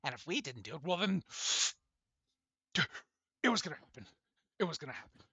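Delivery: eerie voice lurching between high and low; weird shifting voice. Acted. Shadowed figure justifying killing the gods, weird high-and-low voice. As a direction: jump in pitch, getting loud and high at the end. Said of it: "like, high and low pitch, this weird voice".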